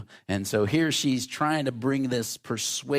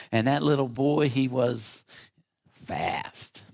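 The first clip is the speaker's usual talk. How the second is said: normal talking voice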